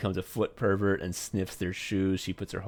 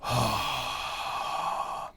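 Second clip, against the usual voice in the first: big breath out; 3 dB quieter than usual. Shown as a demonstration. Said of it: "what she wants is for you to go".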